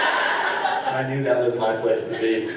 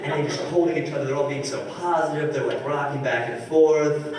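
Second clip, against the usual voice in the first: ordinary talk, nothing marked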